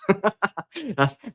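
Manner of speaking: short, high-pitched real laugh; amused laugh. Real, not acted. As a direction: laugh at your own joke. Laughing at his own bit.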